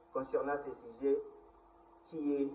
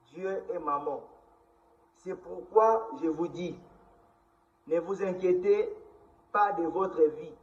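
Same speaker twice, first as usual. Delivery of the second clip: solemn public proclamation, higher and louder; scripture reading; 4 semitones higher, 6 dB louder than usual. Acted. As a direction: proclaim the verses loudly, phrase by phrase. Reads Bible verses aloud.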